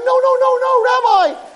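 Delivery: high, urgent string of denials; panicked protest. Acted. Peasant taken for a thief by the rabbi, panicked protest. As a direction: plead frantically to be heard.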